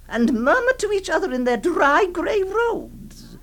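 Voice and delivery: normal talking voice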